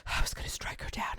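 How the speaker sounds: hushed whisper; a whispered sinister thought. Acted. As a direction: whisper it like a dark secret. Narrating Joseph's secret thought.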